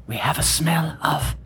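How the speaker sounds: ASMR whisper. Acted. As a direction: hush it close to the mic. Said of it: "A S M R".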